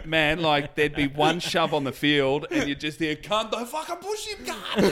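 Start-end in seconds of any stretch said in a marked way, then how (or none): 3.1-4.8 high-pitched mock bogan yell; impersonating an angry sideline dad; yelling footy bogan. Yelling bloke at junior footy.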